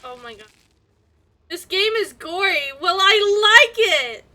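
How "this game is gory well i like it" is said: loud and drawn out; gleeful gory delight; excited. Real, not acted. Excited reaction to gory game.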